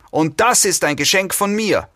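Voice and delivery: high-pitched, restrained female yell; screaming woman. A narrator's reading. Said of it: "schrie die Frau".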